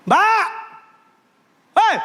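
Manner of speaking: sharp high yell at the maid; a host yelling for the maid, high and loud. Retold or imitated. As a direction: bark the name out loud and high. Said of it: "dia teriak".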